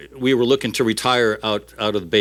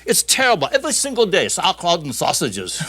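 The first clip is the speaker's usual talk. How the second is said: disgusted German, starting high; comic German complaint, slowing and dropping at the end; German worker; 6 semitones higher than usual. Acted. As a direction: grumble like a fed-up German. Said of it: "the German opens his, and he says".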